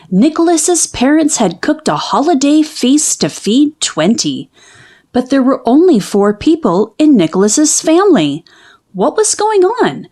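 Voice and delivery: plain reading voice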